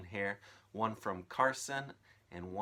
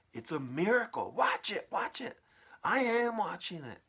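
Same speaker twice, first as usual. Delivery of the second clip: very high, loud; ecstatic cries; 11 semitones higher, 9 dB louder than usual. Acted. Aunts marvelling at the growing peach, high and loud. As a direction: cry out in wonder.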